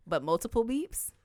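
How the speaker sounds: usual speaking voice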